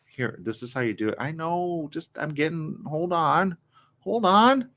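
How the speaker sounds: softly acted patience with a slow older worker; imagined coworker exchange. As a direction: play it small and soft, stalling. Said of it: "the other workers are like".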